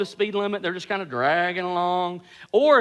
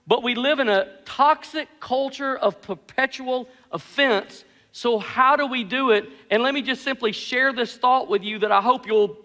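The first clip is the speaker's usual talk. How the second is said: usual speaking voice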